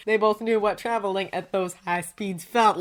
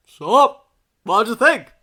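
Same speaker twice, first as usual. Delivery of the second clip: much louder, higher greeting; sudden loud call; 3 semitones higher, 11 dB louder, slower than usual. A narrator's reading. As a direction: boom it out, startling the listener. Character's voice bursts from earpiece.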